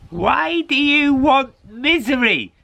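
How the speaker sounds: put-on high, loud old woman's question; old lady. Old lady in her cave questioning the cat, high and loud. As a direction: ask the cat why, like a puzzled old crone. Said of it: "said the old lady".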